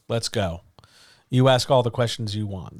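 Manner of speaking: ordinary talk, nothing marked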